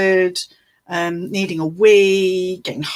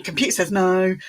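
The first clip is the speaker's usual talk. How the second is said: ordinary delivery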